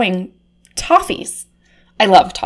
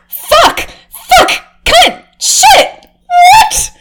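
shrieking in disgust; 5 dB louder, much slower than usual. Acted. Shrieking, stuck in goo.

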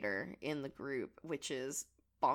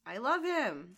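gushing; 6 semitones higher, 8 dB louder than usual. Real, not acted. Gushing over a favourite villain.

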